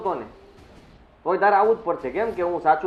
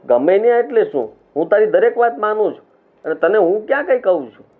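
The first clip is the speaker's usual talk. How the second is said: higher-pitched, stung objection; indignant protest; 6 semitones higher, 3 dB louder than usual. Acted. Husband stung by wife's marriage complaint, indignant protest. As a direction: push back, wounded, with rising pitch.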